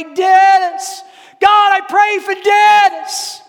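loud, high cry with drawn-out name; shouted prayer. Retold or imitated. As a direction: cry out to God at full voice, pitched high. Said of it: "I got louder and louder".

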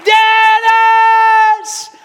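very high, long-drawn shout of a name; top-of-lungs shout. Retold or imitated. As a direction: scream the name out, holding it long. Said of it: "shouting at the top of my lungs".

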